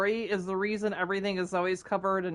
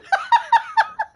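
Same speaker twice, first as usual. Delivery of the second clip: high squeal of a laugh; high burst of laughter; 7 dB louder than usual. Real, not acted. Cracking up at the volcano pun, high squealing laugh. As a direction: squeal with laughter at your own joke.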